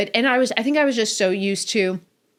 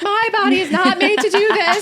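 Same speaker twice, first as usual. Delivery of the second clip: high, louder whiny protest, half-laughing; mock protest; 12 semitones higher, 4 dB louder than usual. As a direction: play up your refusal, higher and louder. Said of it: "I'm like".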